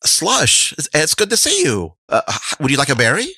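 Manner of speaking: high-pitched, lightly voiced friendly greeting; Russell. Russell greeting Slush and offering a berry, high voice. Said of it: "Russell goes".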